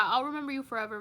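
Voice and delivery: ordinary talk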